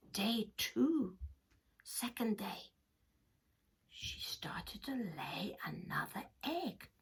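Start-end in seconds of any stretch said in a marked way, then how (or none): 1.8-6.9 soft whispered narration. A narrator's reading. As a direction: whisper like telling a secret. Whispered storytelling.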